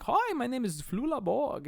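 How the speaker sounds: hammed-up German accent; Flula Borg. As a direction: introduce yourself in a put-on German accent. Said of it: "super thick German accent. He's like".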